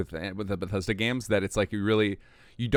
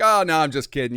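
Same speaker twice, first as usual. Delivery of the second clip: mock British robot; 8 semitones higher, 10 dB louder than usual. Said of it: "It would start talking to you with the British accent".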